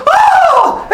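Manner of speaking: very high shriek of fright; acted scream. Housemaid dropping the tray, high acted scream. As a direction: shriek as if caught out.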